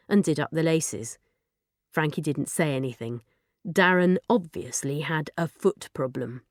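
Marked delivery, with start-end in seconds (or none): none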